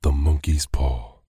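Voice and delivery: a loud, startled cry; sudden loud wild cry. A narrator's reading. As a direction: cry out wildly in the dark. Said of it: "she cried wildly".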